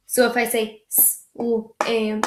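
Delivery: "slam" cut up into sounds; slow broken "slam"; choppy wrong way. Shown as a demonstration. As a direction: demo the wrong way. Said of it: "that shouldn't be how it is".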